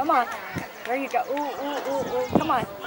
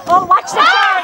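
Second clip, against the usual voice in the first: shouting; 9 semitones higher, 5 dB louder than usual. Real, not acted. Parent cheering at kids' game.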